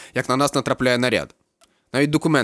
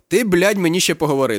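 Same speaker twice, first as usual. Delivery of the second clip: sharp, high and louder, irritated; nervous outburst; 8 semitones higher, 5 dB louder than usual. A narrator's reading. Captain scolding the student, nervous and loud. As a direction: snap at the cheeky student, raising the voice. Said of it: "нервує Микола Іванович".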